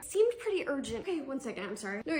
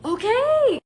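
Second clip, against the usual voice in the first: pushy mom; 10 semitones higher, 10 dB louder than usual. Acted. Mom warns about bedtime.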